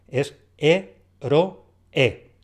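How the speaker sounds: "E-ro-e" very slow, one syllable at a time; split into syllables. Shown as a demonstration. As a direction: spell out "E-ro-e" syllable by syllable. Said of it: "porque tiene tres sílabas". ("E-ro-e" muy lento, separando sílabas)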